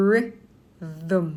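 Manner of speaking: "ริ", pause, then "them" as a weak thum; syllable by syllable. Shown as a demonstration. Teacher breaks rhythm down for learners, syllable by syllable "ริ / them". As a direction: break "ริ / them" into two separate beats. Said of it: "สระอึข้างหลัง".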